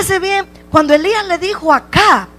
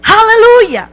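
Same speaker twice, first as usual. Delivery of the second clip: higher, slower climactic preaching; fervent preaching; 4 semitones higher than usual. Acted. Preacher urging the church to face its mountain, fervent and higher. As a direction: build the appeal to a climax.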